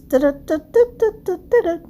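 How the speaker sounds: high "Da-da-da" fanfare hum; sung drumroll. Real, not acted. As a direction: build suspense with a sung drumroll. Drumroll before the cake reveal.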